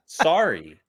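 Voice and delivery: ordinary talk, nothing marked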